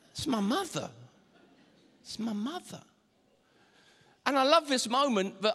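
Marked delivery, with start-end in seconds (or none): 0.1-0.9 mildly sheepish aside to the crowd; awkward, played for comedy; mock embarrassed explanation. Mock embarrassed reply to heckler. 2.0-2.8 much softer, embarrassed excuse; hushed mock embarrassment; sheepish murmur. Same excuse repeated, much softer.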